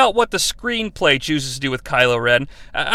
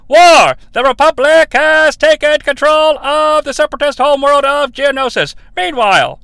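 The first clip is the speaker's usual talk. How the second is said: loud, high forties announcer; old newsreel; 8 semitones higher, 5 dB louder than usual. Acted. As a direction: read it like a wartime newsreel. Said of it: "that is a forties announcer guy".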